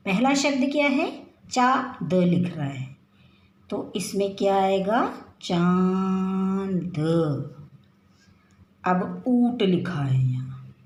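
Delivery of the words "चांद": चांद drawn out, nasal आं held; teacher modelling the answer चांद; slow nasal demo. Shown as a demonstration. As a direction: stretch चांद. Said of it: "चंद्र बिंदी आई अनुनासिक".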